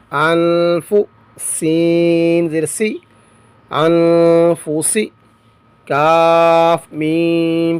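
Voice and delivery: slow, drawn-out spelling in a reciting tone; measured recitation drill. Shown as a demonstration. Teacher spells Quranic words aloud for students, measured recitation. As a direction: chant each letter and vowel as if before a class.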